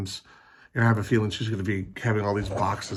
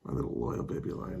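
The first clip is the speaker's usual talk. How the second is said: whispered coo; 8 semitones lower, 8 dB quieter than usual. Real, not acted. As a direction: whisper it lovingly to the pet. Cooing to the dog.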